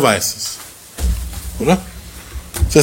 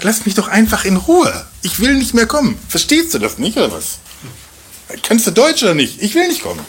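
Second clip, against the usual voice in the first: higher, exasperated rebuff; irritated refusal; 7 semitones higher than usual. Retold or imitated. Russian man telling the church elder to leave him alone, exasperated. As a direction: push him away, fed up.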